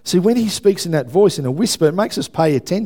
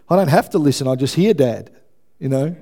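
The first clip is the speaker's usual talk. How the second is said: ordinary talk, nothing marked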